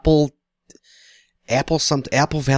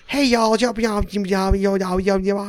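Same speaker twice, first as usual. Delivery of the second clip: high, garbled Southern waitress babble; exaggerated, unintelligible Southern drawl, pitched way up; 6 semitones higher, slower than usual. Waitress greeting baffled out-of-state diners in a thick Southern drawl. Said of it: "southern drawl. We couldn't understand a word she was saying".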